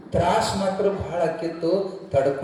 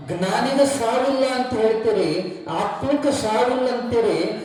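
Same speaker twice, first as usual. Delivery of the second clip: higher, astonished questioning; puzzled crowd; 7 semitones higher than usual. Acted. Townsfolk questioning the weeping guru, high and astonished. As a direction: ask in wonder why a sage weeps.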